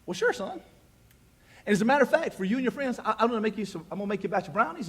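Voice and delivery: a hint of a sugary mom; lightly voiced mother, sweetly agreeing. Mom telling her son she'll bake brownies, lightly sweet.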